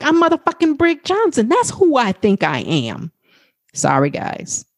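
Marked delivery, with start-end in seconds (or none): 0.0-3.1 bold comeback. Shown as a demonstration. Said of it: "that's the energy in which I want you to answer".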